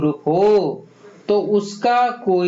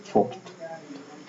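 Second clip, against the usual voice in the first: breathy whisper, dropped right down; whispered answer; 11 dB quieter, much slower than usual. Acted. Teacher hinting the answer to students, whispered. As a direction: whisper the answer like a hint to the class.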